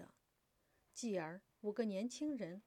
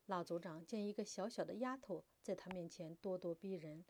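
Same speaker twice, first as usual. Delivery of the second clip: plain reading voice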